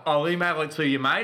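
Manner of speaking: hammed-up Australian accent. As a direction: lay on an Aussie twang for fun. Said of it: "I don't know what accent this is now".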